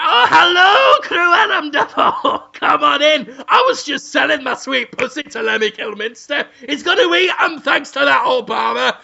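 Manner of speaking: high, loud, gushing welcome; hammed-up housewife greeting. Housemaid wife lets the villain in, high shouted welcome. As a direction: squeal hello and chatter on in a high voice.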